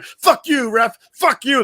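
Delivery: hammed-up screaming at the referee; mock furious yell. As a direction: scream it like a spoiled player. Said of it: "And he was like".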